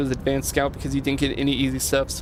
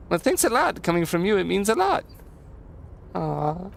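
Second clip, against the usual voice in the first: lilting, folksy thanks starting high; simple helper, pleased by praise; touched Leo; 3 semitones higher than usual. Acted. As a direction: play a grateful, humble handyman. Voices Leo thanking a friend.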